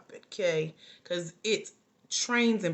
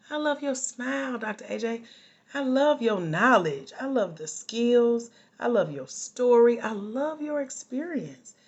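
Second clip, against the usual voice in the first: mock gushing; 4 semitones higher, 4 dB louder than usual. Coach repeating what followers tell her, mock gushing. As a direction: pile up compliments like an adoring fan, pitched up.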